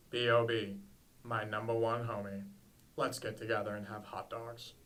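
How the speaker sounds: deep, low, velvety narrator voice; Morgan Freeman voice. Pretend. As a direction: keep it low and grand like a film narrator. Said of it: "Do the Morgan Freeman voice right now".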